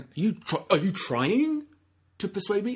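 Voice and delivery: mock defensive retort; defensive challenge. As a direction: bristle as if accused of pushing. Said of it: "he's not going".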